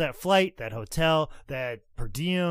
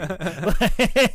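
loud, high burst of laughter; big helpless laugh at your own story; 5 semitones higher, 8 dB louder than usual. Real, not acted. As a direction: crack up mid-story. Cracks up at his own story.

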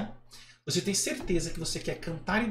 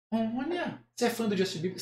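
ordinary delivery

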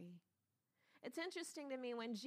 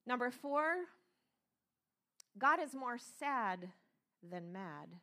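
preaching point; 4 semitones higher, 7 dB louder, slower than usual. Acted. Preaching point number four.

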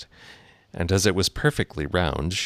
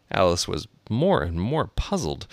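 ordinary delivery

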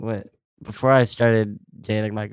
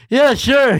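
much higher, louder fake-bright yes; mock cheerful; 14 semitones higher, 7 dB louder than usual. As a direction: play it over-the-top chipper while wearing gloves. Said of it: "I'm like".

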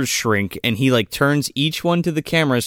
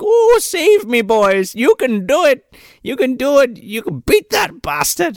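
pleading captive; 9 semitones higher, 3 dB louder than usual. Retold or imitated. Chained captive cheering on his boys, high-pitched pleading. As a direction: cry out high to rally your rescuers.

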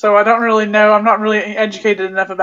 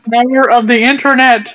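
usual speaking voice

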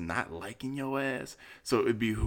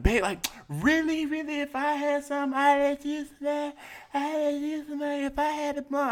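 high, cooing mock girly voice; high sugary imitation of her wheedling; mimicking her sweet-talk. Mimicking the woman sweet-talking.

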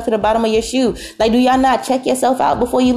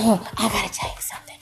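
much softer, secretive whispered imitation; mock whisper; 8 dB quieter than usual. Guest sharing a secret with the server, mock whisper. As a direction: whisper it like an overly close guest. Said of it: "Don't be coming to whispering all in my face".

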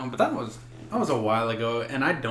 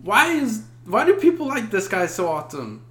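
incredulous; 9 semitones higher, 4 dB louder than usual. Retold or imitated. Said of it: "I'm like".

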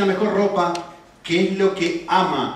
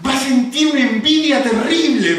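put-on higher, louder childish gloat; gleeful child; 7 semitones higher, 6 dB louder than usual. Father imitating daughter gloating about her neighbor's envy. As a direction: gloat like a proud little girl. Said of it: "me dice".

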